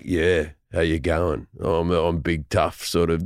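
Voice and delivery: low, slowed tough-guy Aussie voice; deep Australian actor. Acted. Australian actors all acting big and tough, deep voice. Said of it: "deep in the register".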